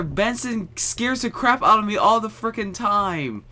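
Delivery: high-pitched heated complaint; rant, still rattled from a scare; worked-up exasperation. Real, not acted. Player complaining about Benson after a scare, exasperated.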